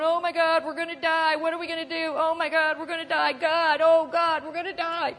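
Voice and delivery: high, loud panicked cries of doom; panicked wailing. Retold or imitated. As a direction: wail like a crowd sure they'll die. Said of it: "they're going".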